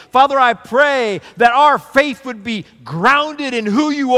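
heartfelt pulpit prayer; pastor's prayer. Acted. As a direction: pray for the church, rising and falling. Leads closing prayer over music.

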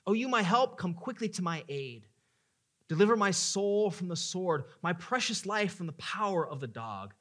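loud pleading prayer, pitch up high; crying out to God. Acted. Preacher voicing David's cry, loud pleading. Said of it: "Hear what David says".

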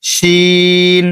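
শিন held very long as a demo; Arabic letter shin. Shown as a demonstration. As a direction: draw out শিন slowly and clearly. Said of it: "এখান থেকে উচ্চারণ করবেন".

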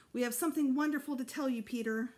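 quick, lifted voice of a mother with news; bright announcing mother. A narrator's reading. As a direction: mom sharing big news, quick and high.

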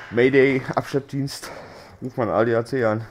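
loud, hammed-up mayday call for a tow; mock distress call. Stuck in the game, jokingly calls a tow truck, hammed-up loud mayday. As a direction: yell it like a joke emergency radio call.

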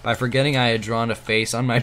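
ordinary talk, nothing marked

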